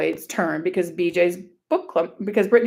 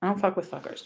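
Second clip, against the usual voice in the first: usual speaking voice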